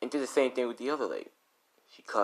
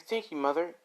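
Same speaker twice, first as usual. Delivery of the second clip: higher, polite daughter voice; 8 semitones higher than usual. Acted. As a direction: sweetly thank her. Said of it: "she's like".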